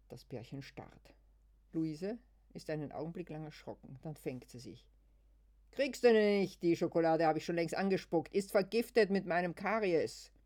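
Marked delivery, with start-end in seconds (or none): 5.7-10.3 loud, higher taunting yell; shouted retort. Acted. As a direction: spit the taunt back loudly. Luise kontert laut. (laut höhnisches Kontern)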